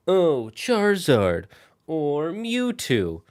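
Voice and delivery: lightly mocking, drawn-out fan voice; imitating typical fans. Streamer mimics Gen one fans' favorite picks, lightly mocking. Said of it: "as their favorite are like".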